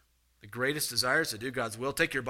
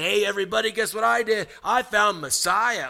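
boastful announcing; 5 semitones higher, 6 dB louder than usual. Pretend. Imagined boastful announcement to town.

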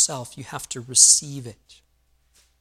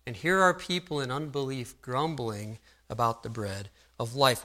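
normal talking voice

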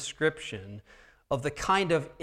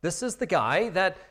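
eager sudden realization; excited Peter; 6 semitones higher than usual. Acted. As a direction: voice rising with recognition. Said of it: "Peter figures out".

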